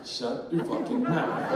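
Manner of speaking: slow, restrained warning; wife's rebuke. Acted. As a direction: measure out each word as a threat. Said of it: "and my wife goes".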